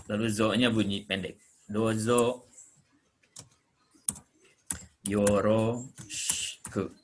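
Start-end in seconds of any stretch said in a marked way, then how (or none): 1.7-6.9 "Dozo yoroshiku" slowly, long o in "Dozo"; slow spelled-out demo. Shown as a demonstration. Teacher showing students the long do, said slowly. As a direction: draw out "Dozo yoroshiku" syllable by syllable. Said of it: "do-nya bunyi panjang pakai u".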